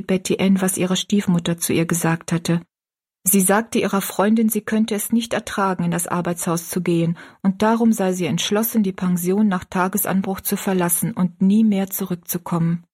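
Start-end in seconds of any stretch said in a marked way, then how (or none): none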